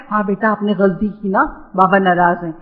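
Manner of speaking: imitating a tender grandmother calming a child; gentle grandparent. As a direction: soothe the grandchild kindly.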